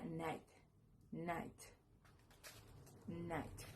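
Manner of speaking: "night" as one isolated syllable, repeated; teacher sounding out "night" for learners; pronunciation demo. Shown as a demonstration. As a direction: model "night" with pauses between repeats. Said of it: "pero se pronuncia".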